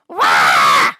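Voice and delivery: deep, loud startled roar-shriek; dragon's shriek. Acted. Dragon Margaret startled by the boy, loud deep shriek. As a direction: bellow in fright. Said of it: "Margaret shrieked".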